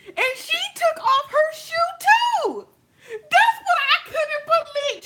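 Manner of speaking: high, loud squeal, close to crying; shrieking disbelief. Real, not acted. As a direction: squeal the twist you can't believe. Retelling the shocking twist.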